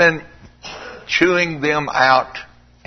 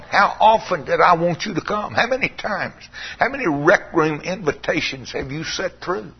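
ordinary talk

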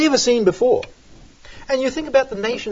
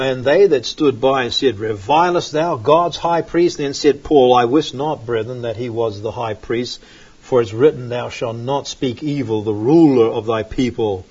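lower, measured Bible reading; scripture reading; 8 semitones lower than usual. Acted. Preacher reading Acts to the congregation, lower and measured. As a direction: read the verse lower and steady.

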